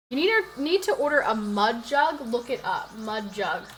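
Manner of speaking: ordinary talk, nothing marked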